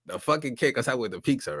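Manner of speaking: usual speaking voice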